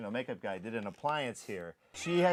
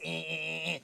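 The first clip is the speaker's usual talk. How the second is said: mock fogger sputter; 3 semitones higher, 4 dB quieter than usual. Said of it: "So we'd go to spray it".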